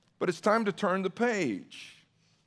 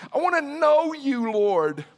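raised, pleading call; impassioned cry; 6 semitones higher, 5 dB louder than usual. Acted. Preacher calling out to the Lord, impassioned cry. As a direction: cry out high and loud, then drop on the last word. Preacher voices a plea to God.

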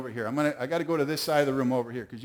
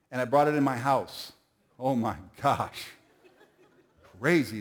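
ordinary talk

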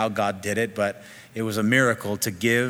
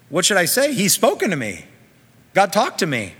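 high, loud, astonished exclamation; acted amazement; 8 semitones higher, 5 dB louder than usual. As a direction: exclaim in wonder as the awed king. Voicing Hezekiah amazed God spoke.

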